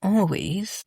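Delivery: loud, fed-up sigh of a line; exasperated. Real, not acted. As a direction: roll your eyes through it. Exasperated about Kevin.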